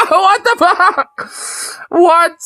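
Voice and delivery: high, incredulous laugh into the words; stunned squeaky laugh; laughing disbelief. Real, not acted. Stunned reaction to the clip.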